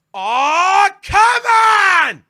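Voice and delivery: full-volume, high-pitched yell of disbelief; hammed-up, exasperated protest at the top of the voice; exasperated shout. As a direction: bellow it like a fed-up fan. Acts out his exasperated reaction.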